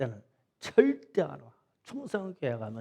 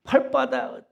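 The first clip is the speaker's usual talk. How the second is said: exclaiming; 11 semitones higher, 8 dB louder, slower than usual. Retold or imitated. Crying out about the feet.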